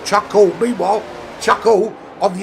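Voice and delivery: high, loud excited race call; live play-by-play. Acted. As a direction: call the overtake at full pace.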